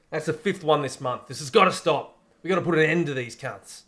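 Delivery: mock angry cop rallying his men; angry police chief. Chief fed up with robberies addressing his crooked henchmen, angry.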